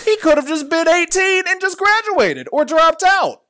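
high, loud outburst of disbelief; real exasperated yell; exasperated shouting. Real, not acted. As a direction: shout it out, pitch way up, at the absurd plot. Exasperated rant about the storyline.

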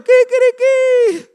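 high, slow crowing kikiriki; rooster crow. Retold or imitated.

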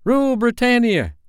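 put-on very high, loud boyish squeak. Curly defying the pirates, high loud squeak. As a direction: squeak it out patriotically. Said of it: "squeaked Curly".

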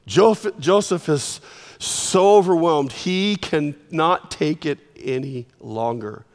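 ordinary delivery

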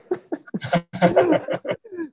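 long high burst of laughter. Real, not acted. Retelling the Naples prank, bursting into high laughter.

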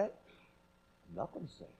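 half-voiced aside; under breath. Real, not acted. Preacher catches himself mid-example, a barely voiced aside. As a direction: murmur it as if to yourself. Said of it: "And I could say".

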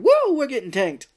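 high, loud party cheer; excited cheer. Retold or imitated. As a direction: whoop like workers ready to drink. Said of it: "And everyone's like".

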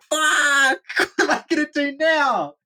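high-pitched mock-panicked cry; panicked yelp. Storyteller reliving the boat drifting out of reach, panicked yelp. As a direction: yelp in hammed-up dismay.

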